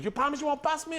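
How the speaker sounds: high-pitched imitation of a distrustful friend; mock wary pleading. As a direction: ask for reassurance in a high voice. Wary friend asks for promise.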